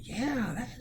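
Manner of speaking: high, hesitant put-on voice; next year's teacher. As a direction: stammer agreement as an imagined teacher. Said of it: "whoever the new social studies teacher is".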